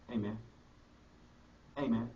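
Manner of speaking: quiet call. Retold or imitated. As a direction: murmur it to yourself in the noisy room. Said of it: "Then I scream it".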